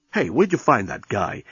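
plain reading voice

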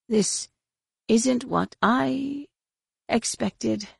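weak, halting. A narrator's reading. Injured Magister held hostage, weak and halting. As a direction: struggle out a few words at a time.

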